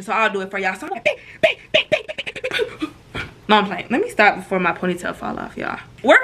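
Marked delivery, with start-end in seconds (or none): 1.0-3.2 squeaky, breaking-up imitated hits; punch-noise demonstration. Shown as a demonstration. Storyteller demonstrating the fight for viewers, squeaky punch noises. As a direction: rattle off high mock punches. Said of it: "y'all love my demonstration".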